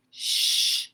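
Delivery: hissed shh; a short shushing hiss; sharp shush. Acted. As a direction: shush him firmly. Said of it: "said Meatball".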